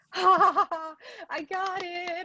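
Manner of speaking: put-on high, cackling triumphant kid; gleeful Andrew. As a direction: crow over the win. Andrew voiced gloating over find.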